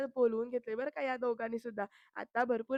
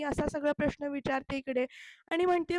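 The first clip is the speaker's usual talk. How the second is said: ordinary talk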